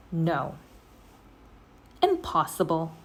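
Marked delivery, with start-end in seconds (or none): none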